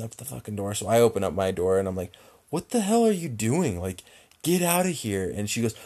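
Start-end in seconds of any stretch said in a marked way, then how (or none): 2.5-5.3 high, indignant mock yell; raised-pitch outraged demand; retold angry confrontation. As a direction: act out chasing off an intruder. Confronts lady yanking car door.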